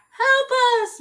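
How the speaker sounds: high, loud cry for help; calling for help. Acted. Missus Watson calling out for help. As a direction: cry out from far away. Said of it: "Missus Watson called".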